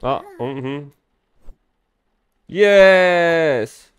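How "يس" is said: loud, higher cheer; triumphant yes. Real, not acted. Gamer shoots the baby like a basketball, triumphant cheer. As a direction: celebrate the basket.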